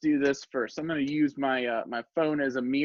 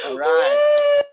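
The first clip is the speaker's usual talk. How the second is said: triumphant shout; 13 dB louder than usual. Pretend. Big finish after the song.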